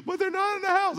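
high-pitched mock worry; hammed-up anxious wife; imitated worried wife. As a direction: fret in a wife's high voice. Wife's worried protest, acted out.

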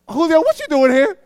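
high, loud surprised call; startled to find the server there. Retold or imitated.

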